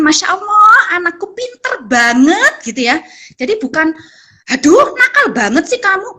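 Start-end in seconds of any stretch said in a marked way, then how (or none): 0.0-2.7 higher admiring exclamation, slow praise after; mother praising a busy toddler; praising child. Shown as a demonstration. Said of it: "kita bisa mengucapkan". 4.5-6.2 high, exasperated scold; a tired mother snapping at the child; annoyed parent scolding. Shown as a demonstration. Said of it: "bukan seperti itu ya".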